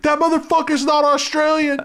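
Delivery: very high, loud yell from the back; squawking crowd heckler; heckler's shout. Acted. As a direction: shout out in high-pitched mock outrage. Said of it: "somebody stands up in the back".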